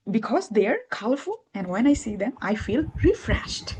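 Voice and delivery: deliberate model reading, lifting and dropping on "Because they are colorful"; English intonation. Shown as a demonstration. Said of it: "এই যে কথা".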